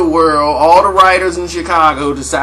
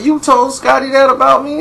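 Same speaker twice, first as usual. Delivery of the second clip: higher, louder accusation; accusing Charles; 10 semitones higher, 5 dB louder than usual. Retold or imitated. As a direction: confront him sharply. Voicing Charles confronting Mike.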